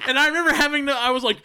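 usual speaking voice

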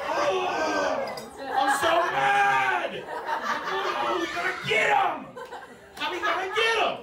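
high, loud, laughing mock tough-guy rant; angry rapper. Grown men in the studio hyping themselves up, high and loud. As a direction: ham up a worked-up gangster. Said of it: "they're like".

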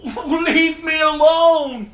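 slow, high, shouted leave me alone; a final anguished plea to be left alone; screaming at God. Retold or imitated. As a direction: drag out alone in a loud, broken shout. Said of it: "as I'm screaming".

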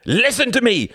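high, loud shout. Acted. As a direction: shout at her. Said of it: "he shouted".

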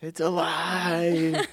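high, slow, drawn-out It's alive; hammed-up, laughing mad scientist; mad scientist cry. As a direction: stretch it out like the mad doctor in Young Frankenstein. Quoting Young Frankenstein monster scene.

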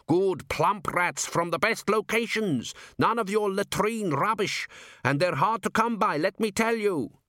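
high-pitched boastful protest; indignant shout. A narrator's reading. Cook defends his rats to the crowd, indignant mock shout. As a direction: talk up your wares, pitched high. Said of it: "shouted Gimlet".

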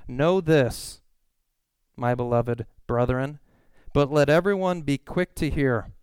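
slow, weighty scripture reading; solemn Bible reading, slow. Acted. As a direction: read the verse slowly, letting each phrase land.

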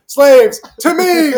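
loud, high summoning cry; mock rallying shout. Mocking Abe calling the slaves over, shouted. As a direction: bellow it like a mock commander.

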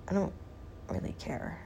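soft unvoiced shrug; muttered aside. Real, not acted. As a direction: throw it away under breath.

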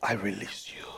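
low, soft, solemn Gandalf. Retold or imitated. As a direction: breathe the words out deep and quiet. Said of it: "and I love this part".

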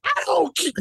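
real exasperated yelling; outraged shout. Real, not acted. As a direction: yell it twice, climbing. Shouting back at cohost.

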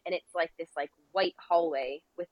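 ordinary talk

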